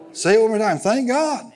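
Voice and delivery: high-pitched pulpit call for a response; rousing call. Real, not acted. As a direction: lift the pitch high to rally the congregation. Rousing congregation to repeat once more.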